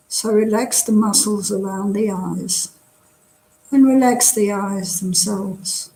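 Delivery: calm, unhurried meditation guide; guided meditation. Real, not acted. Teacher settling an online class into body scan, guided meditation. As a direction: talk them gently through relaxing, with long pauses.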